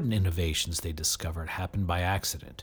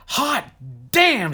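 loud, high exclamation; sudden high shout of joy; 12 semitones higher, 6 dB louder than usual. A narrator's reading. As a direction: blurt it out big. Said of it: "shouted Michael".

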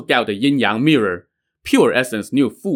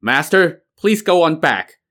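firm, raised, unbending refusal; stern; 6 semitones higher, 4 dB louder than usual. Acted. Pupil defying his own master, stern refusal. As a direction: draw a line he won't cross. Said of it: "Yin Hong now said sternly".

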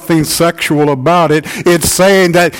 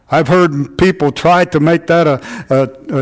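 usual speaking voice

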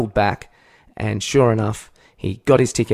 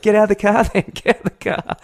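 high-pitched goading, starting loud; acted challenge; 11 semitones higher, 5 dB louder, faster than usual. Runner daring driver out of car, raised high voice. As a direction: call his bluff, voice raised high.

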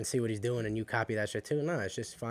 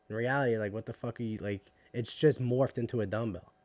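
ordinary talk

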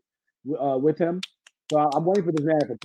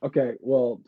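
usual speaking voice

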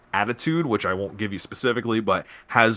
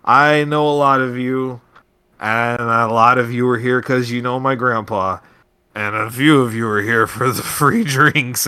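put-on drunk slurring; 5 dB louder than usual. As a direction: drag the words out, half drunk. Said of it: "She's slurring a little bit".

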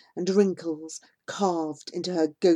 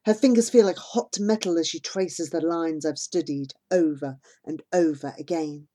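ordinary delivery

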